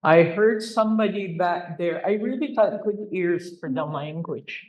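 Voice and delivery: normal talking voice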